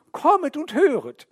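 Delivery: high, loud snake summons; snake calling. A narrator's reading. The snake summons the ravens to judge, loud and high. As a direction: call them in as the serpent.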